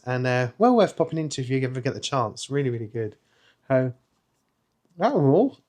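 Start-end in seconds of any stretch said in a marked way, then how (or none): 3.7-5.6 cigar in mouth, words a bit muffled; talking while smoking. Real, not acted. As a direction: talk around a cigar between puffs. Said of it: "I'm trying to talk and smoke at the same time".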